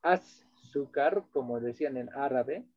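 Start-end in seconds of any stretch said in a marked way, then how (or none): 0.0-1.2 azúcar drawn out slowly, "as-sú-car"; Arabic-derived azúcar. Shown as a demonstration. Teacher slowly showing the word azúcar to students. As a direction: stretch out azúcar slowly for the class. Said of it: "En Aymara ya no decimos".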